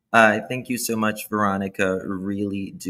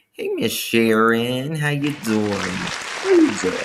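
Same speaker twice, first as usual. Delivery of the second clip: rising higher, the second drawn out slow; high playful greeting; 4 semitones higher, 4 dB louder than usual. Pretend. Host greeting Sharon in a high drawn-out voice. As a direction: sing it up high and stretch it.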